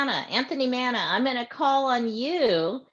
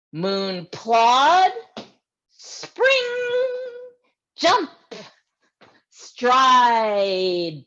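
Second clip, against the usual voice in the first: playful reading; 7 dB louder, much slower than usual. Acted. Reads bouncing moonwalk poem.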